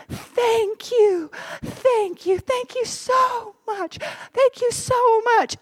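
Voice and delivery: high, slow emotional gratitude; choked-up thank you; tearful thanks. Retold or imitated. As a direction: thank the helper through tears.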